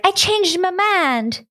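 a slow, high, drawn-out mock Southern accent. Dying mugger's wife announces her change of heart in a mock Southern accent. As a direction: stretch it out in a hammed-up Southern accent. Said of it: "fucking Southern at the end of his life".

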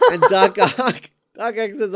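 breaking into laughter, loud; laughing. Real, not acted. Retelling the movie scene to a friend, cracking up. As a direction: laugh mid-name.

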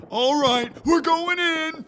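loud, slow, high barked order; raid command shout. Acted. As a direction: call the raid to go.

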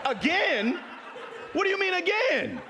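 high, loud mock disbelief; hammed-up incredulous yell; shocked outburst. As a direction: cry out in comic alarm. Acted shocked outburst in story.

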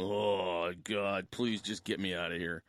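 a hint of a desperate traveller's muttered prayer; weary plea. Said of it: "I start going".

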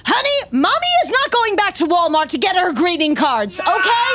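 exasperated mom. Acted. Mom character answering child.

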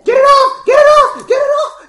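very high, loud repeated shriek; panicked screaming. Acted. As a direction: scream for help as if something is clamped on you. Player's character under attack screams.

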